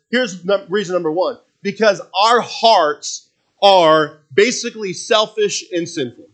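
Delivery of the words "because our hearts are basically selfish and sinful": slow, louder preaching stress; slow pulpit emphasis. Real, not acted. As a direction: drive the point home slowly. Preaching main point.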